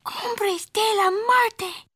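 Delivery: high, slow, spooked voice; shivery. A narrator's reading. Poquito Tito announcing the Martians, shivery. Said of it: "with a shiverito".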